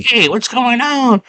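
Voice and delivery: high, slow cartoonish drawl; drawn-out mock greeting up high; creature greeting. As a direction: call out as a silly creature, pitched high. Voices the red creatures' greeting.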